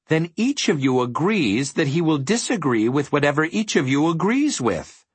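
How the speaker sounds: mildly triumphant, pitch held up. A narrator's reading. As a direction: build the winning argument with glee. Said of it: "said Milo triumphantly".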